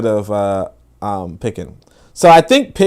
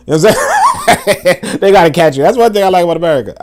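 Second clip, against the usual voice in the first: laughing; 7 semitones higher, 4 dB louder than usual. Real, not acted. Laughing at his own joke.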